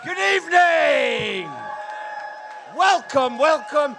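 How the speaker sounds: slow, high shouted greeting; comic greeting the whole room; big greeting shout. Real, not acted. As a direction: stretch it out to the back row.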